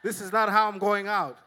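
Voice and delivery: loud fighting declaration; defiant loud vow. Retold or imitated. Pastor facing the dog, loudly defiant. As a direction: shout your resolve. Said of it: "I'm like".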